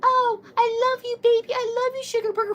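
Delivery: very high, loud mocking lovey-dovey coo; mock sappy couples by cooing way up high; mushy lover voice. Mocking couples on Valentine's Day, very high sappy coo. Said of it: "that mushy ass".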